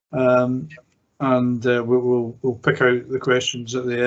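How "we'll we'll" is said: ordinary delivery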